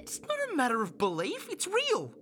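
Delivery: heated, high-pitched insistence; defensive protest. Acted. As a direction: insist hotly, voice climbing. Defensive argument with doubting partner.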